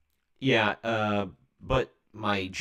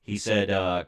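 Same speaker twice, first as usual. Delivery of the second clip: ordinary delivery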